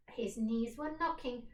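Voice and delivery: ordinary delivery, nothing marked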